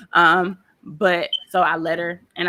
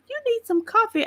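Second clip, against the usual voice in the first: high mock coworker voice. Coworker quoted offering coffee.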